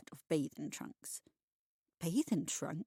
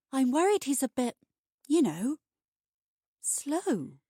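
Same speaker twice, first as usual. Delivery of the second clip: higher, louder worried aside; confiding worry; 6 semitones higher, 7 dB louder than usual. Acted. Peggy confiding her worry about the boy to Minnie, hesitant. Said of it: "she says to Minnie".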